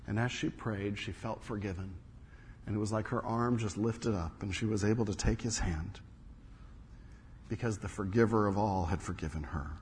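very low, soft, weighty storytelling; hushed dramatic narration. A narrator's reading. Preacher telling of a survivor recognizing her guard, hushed and grave. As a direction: drop right down and let each phrase land between pauses.

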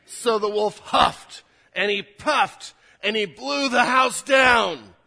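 slow, building to a loud shout; dramatic storytelling shout. Acted. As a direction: huff and puff it out, slow and booming. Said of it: "You can tell how I read at home".

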